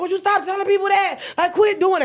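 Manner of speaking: high, loud mock-exasperated whine; exasperated protest. Scolding her friend for telling everyone, high exasperated whine. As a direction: tell him to quit it in a squeaky pitch.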